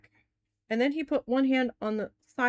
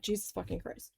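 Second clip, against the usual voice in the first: muttered curse; 3 semitones lower, 11 dB quieter than usual. Retold or imitated. Fed up with comp paperwork.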